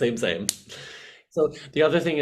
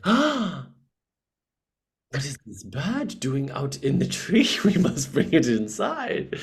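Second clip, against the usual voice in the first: hammed-up, higher-pitched attempt at a French accent; bad French accent; 5 semitones higher than usual. Host imagining caring singer finding a bird, bad French accent. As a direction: gasp and fuss in a botched French accent. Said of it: "She has, like, a French accent".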